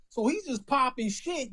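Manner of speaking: ordinary talk, nothing marked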